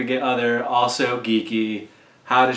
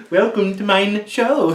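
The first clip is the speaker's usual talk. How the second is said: comic host voice; 8 semitones higher than usual. Acted. Jokey host voice.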